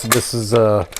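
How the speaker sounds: normal talking voice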